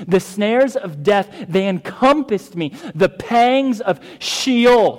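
loud, high, slow scripture proclamation; impassioned preaching. Acted. Pastor reading the psalmist's anguish to the congregation, impassioned preaching. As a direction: hammer out each weighty word to the room.

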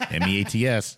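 M E A T S spelled letter by letter. Shown as a demonstration. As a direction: spell M E A T S out clearly. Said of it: "meets like the protein".

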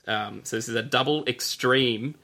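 ordinary talk, nothing marked

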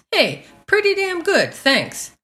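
bright high upbeat reply, said with a smile; upbeat, smiling. Shown as a demonstration. Teacher shows learners the cheerful way to answer how are things, upbeat. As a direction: answer cheerfully, pitched well up. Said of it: "with a smile in a very upbeat tone".

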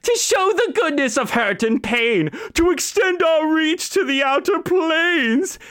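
high, declaiming villain chant; hammed-up anime villain recital; Team Rocket motto. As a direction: recite the motto with cartoon flair. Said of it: "The Tabaxi is Meowth".

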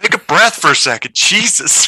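high, loud-ish playful scolding; mock exasperation. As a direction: tell off a gushing fan in jest. Acted scolding of gushing fan.

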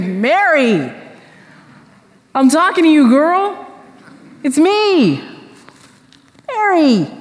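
high, eager call to get her attention; undignified exclamation. Retold or imitated. Jesus calling out to Mary at the tomb, undignified and high-pitched. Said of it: "I don't imagine that Jesus was real dignified".

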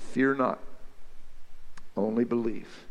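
low, soft and calm; quietly steady a frightened father; gentle reassurance. Acted. Jesus telling the grieving father not to fear, low and soft.

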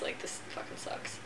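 whispered aside to friends; whispering. Real, not acted. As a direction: hush it under your breath. Aside glance to party.

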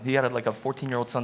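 ordinary talk

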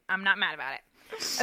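low, soft repeat with a lift at the end; muted mock denial. Real, not acted. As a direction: drop the voice and insist under it. Repeats the denial, lower and softer.

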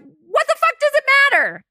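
retold exasperated rant at the therapist; annoyed outburst. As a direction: blurt it out in frustration at the therapist. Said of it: "I'd be so annoyed".

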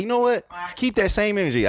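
high taunting retort; defiant retort. Retold or imitated. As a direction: throw the challenge back high. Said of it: "I'm like".

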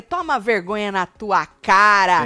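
slow, loud scolding with "cara" drawn out; acted telling-off. Host quotes Dona Adriana letting loose, scolding loudly. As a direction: let the rebuke out forcefully, word by word.

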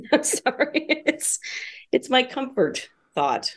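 stifling giggles, barely holding it in; trying not to laugh. Real, not acted. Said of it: "sorry, I'm trying not to laugh".

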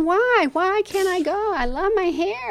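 put-on high, wailing little-girl plea; imitating a child's tearful wail; crying child protest. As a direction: beg like a heartbroken kid. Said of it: "I was screaming and crying".

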